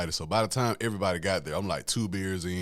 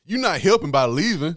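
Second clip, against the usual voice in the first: raised, louder retold objection; protesting; 7 semitones higher, 7 dB louder than usual. Man to the woman who wants to leave, protesting. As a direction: push back, higher and louder.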